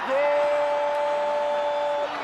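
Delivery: long drawn-out shouted goal; stretched goal call. Real, not acted.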